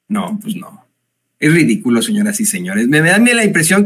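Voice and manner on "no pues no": whispered aside. Real, not acted. Mocking aside after quote.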